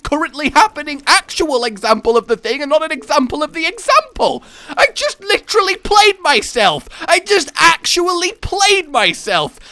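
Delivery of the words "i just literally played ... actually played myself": high, loud exclamation; incredulous outburst. Real, not acted. Streamer realising he played himself, loudly incredulous. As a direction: cry out at your own blunder. Realises he proved his point.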